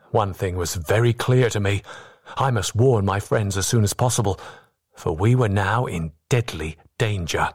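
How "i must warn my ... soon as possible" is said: ordinary delivery, nothing marked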